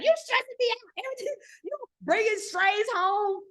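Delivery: high-pitched mock exasperation; stressed-out mama. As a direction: imitate a mother at her wits' end. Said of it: "I was stressing her out".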